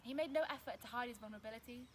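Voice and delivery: ordinary delivery, nothing marked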